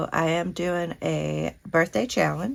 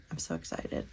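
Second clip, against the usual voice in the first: excited whisper; 13 semitones lower, 10 dB quieter than usual. Real, not acted. Quiet thrill over a gift.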